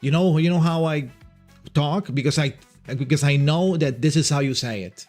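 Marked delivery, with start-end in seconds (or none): none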